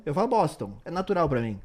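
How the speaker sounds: usual speaking voice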